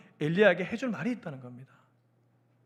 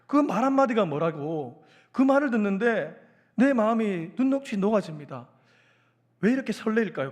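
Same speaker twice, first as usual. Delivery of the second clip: ordinary delivery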